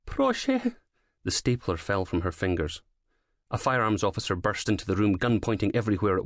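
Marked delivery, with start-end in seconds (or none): 0.1-0.8 pleading Proszę, high and fading. A narrator's reading. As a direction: whimper the word. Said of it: "saying please over and over".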